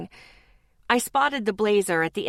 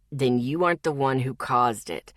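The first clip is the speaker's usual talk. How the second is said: low, level male voice; logical; 8 semitones lower than usual. Acted. Zeke calmly reasons with Lexi, low and level. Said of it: "he pointed out logically".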